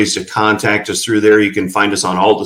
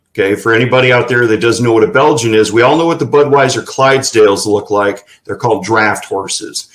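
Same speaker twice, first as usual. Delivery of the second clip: normal talking voice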